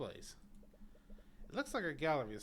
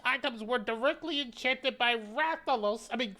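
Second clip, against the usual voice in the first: put-on old man voice; 8 semitones higher, 7 dB louder than usual. Curator voice continues.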